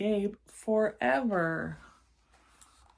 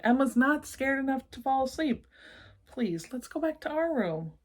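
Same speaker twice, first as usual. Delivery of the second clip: put-on pleading child; 6 semitones higher, 4 dB louder than usual.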